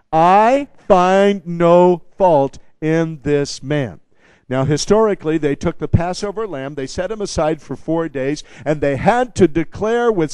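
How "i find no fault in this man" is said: every word spaced, loud and deliberate; slow call and response. Shown as a demonstration. Preacher leading the church to repeat the verdict, slow and loud. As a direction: lead the congregation through "I find no fault in this man" word by word. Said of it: "Say it with me".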